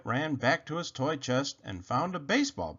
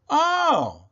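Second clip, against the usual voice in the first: surprised oh; 13 semitones higher, 8 dB louder than usual. Acted. Sudden realization.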